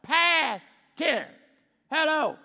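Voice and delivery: high, loud, slowed proclamation; full-force preaching. Real, not acted. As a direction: ring it out from the pulpit.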